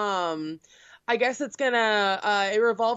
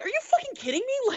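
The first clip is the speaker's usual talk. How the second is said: much higher, fast indignant retort; incredulous outburst; faster than usual. Retold or imitated. Retelling snapping at a classmate in literature class, high incredulous outburst. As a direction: fire back at the classmate in disbelief.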